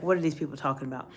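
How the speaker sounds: low, quick, quiet grumble; tired and bored. Retold or imitated. Tired meeting attendee thinking to self, bored and low. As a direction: mimic a worn-out listener's low mumbled thought. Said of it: "you just get kinda tired".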